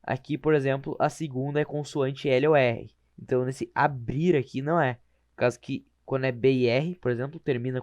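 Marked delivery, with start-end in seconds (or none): none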